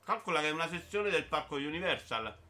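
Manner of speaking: ordinary delivery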